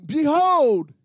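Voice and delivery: brusque answering the phone as Behold. Pretend. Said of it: "do you ever answer your phone".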